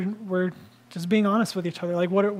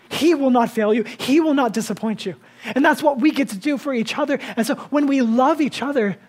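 louder, higher preaching peak; impassioned preaching; 4 semitones higher, 4 dB louder than usual. Acted. Pastor assuring his congregation, fervent and louder. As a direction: proclaim it with full conviction.

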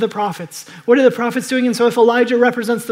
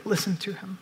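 a soft, reverent echo; hushed; 8 dB quieter than usual. Real, not acted. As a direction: let the command settle quietly. Quietly quoting the Father again.

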